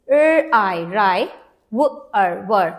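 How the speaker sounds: "R, I" blended into "rai", "w, er" into "were"; sounded out. Shown as a demonstration. Teacher reads the split word for children, sounding out "rai" and "were". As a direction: separate sounds, each pair joined into a syllable. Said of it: "Now let's read this".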